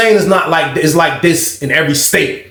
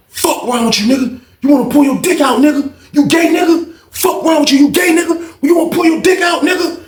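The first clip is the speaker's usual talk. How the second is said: angry shout; 10 semitones higher than usual. Retold or imitated. Chris yelling at the guy who pulled a phone, angry shout. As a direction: scream accusations at him.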